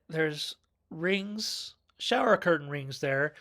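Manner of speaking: ordinary talk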